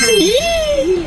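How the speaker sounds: high, drawn-out "yee" squeal; excited squeal. Real, not acted. Cheer after naming the chase.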